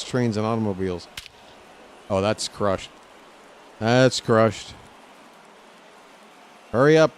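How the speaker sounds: ordinary talk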